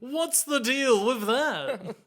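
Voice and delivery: high, drawn-out observational-comic query; mock stand-up comic. Imitating Matt attempting observational humor, mock stand-up comic. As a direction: stretch it out like a hacky stand-up setup. Mocking Matt's observational comedy.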